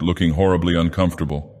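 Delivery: ordinary delivery, nothing marked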